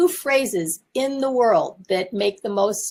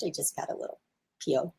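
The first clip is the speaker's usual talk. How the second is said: sheepish mumble; 4 semitones lower, 10 dB quieter, faster than usual. Real, not acted. Sheepish admission.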